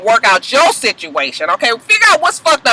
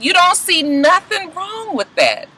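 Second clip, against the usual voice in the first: higher and slow, mock disbelief; incredulous doctor; 6 semitones higher, 3 dB quieter, slower than usual. Doctor questioning Stevie, slow incredulous imitation. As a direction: drag it out like you can't believe him. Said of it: "The doctor is incredulous".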